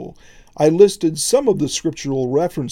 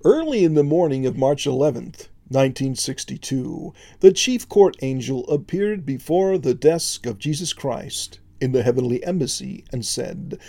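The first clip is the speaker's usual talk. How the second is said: usual speaking voice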